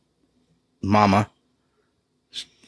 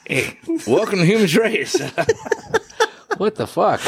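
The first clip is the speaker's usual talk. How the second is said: after laughing hard; 10 semitones higher than usual. Real, not acted. Right after a huge laugh.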